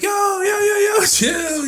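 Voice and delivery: very high, drawn-out 'whoa there' pleading; panicked pleading. Retold or imitated. Airman begging the armed guard to chill, panicked pleading. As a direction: plead in a squeaky, comic panic.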